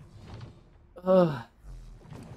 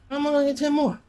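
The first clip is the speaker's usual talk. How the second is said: much higher, strained whine; exasperated whine; 10 semitones higher, much faster than usual. Real, not acted. Player complaining about the task, high exasperated whine. Frustrated gamer, short on time.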